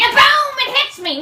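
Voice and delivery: high, loud burst on boom; explosive boom; sudden boom. Acted. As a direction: blurt it like a crash. Sudden realization, sign hits her.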